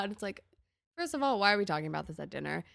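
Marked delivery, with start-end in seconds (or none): none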